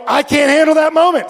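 high, loud acted protest; loud, high-pitched confession; emphatic admission. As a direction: blurt it out at the top of your range. Acts out emphatic refusal.

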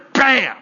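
sudden loud, high blast; imitated explosion bang; explosive "bam". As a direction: burst it out like an explosion.

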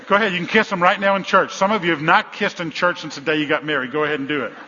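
loud, insistent coaxing of a crowd; loud urging. Real, not acted. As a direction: call out permission over the noise. Urging couples to kiss in church.

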